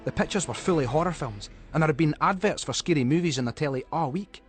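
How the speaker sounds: ordinary delivery, nothing marked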